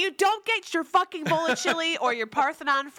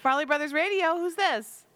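ordinary delivery

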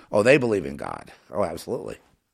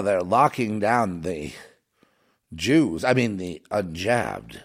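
normal talking voice

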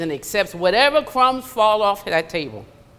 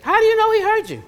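high, loud and fast rhetorical question; fervent preacher's challenge; preaching call; 12 semitones higher, 6 dB louder, faster than usual. Real, not acted. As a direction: drive the question home to the congregation.

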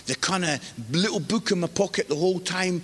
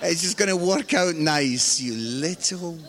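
mock-cheerful sarcasm. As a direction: imitate a dad biting back at his son. Father's sarcastic comeback to son.

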